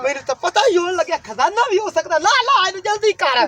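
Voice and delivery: high, climbing treasure excitement; excited urging. Acted. Baba suspects treasure and urges his companion, excited. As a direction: hurry them on, pitch shooting up.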